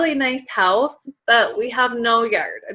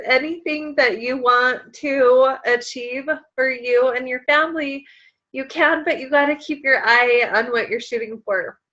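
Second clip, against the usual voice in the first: ordinary talk, nothing marked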